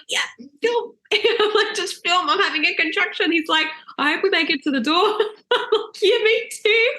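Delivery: high, giggly replies; laughing through pain. Retold or imitated. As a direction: laugh it off mid-contraction. Laughing through contraction in car.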